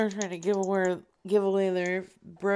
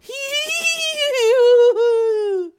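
loud, very high squealing fake laugh; Dog Lettuce laugh impression; 12 dB louder than usual. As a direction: squeal out a long mock laugh. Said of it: "Oh, wait, it's my turn".

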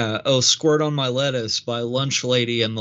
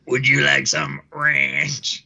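silly voice; slower than usual. Acted. Silly waiter voice.

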